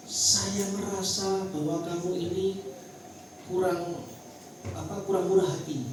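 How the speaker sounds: calm, non-blaming. Shown as a demonstration. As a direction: voice the feeling evenly, without accusing. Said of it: "Ngomongnya gini".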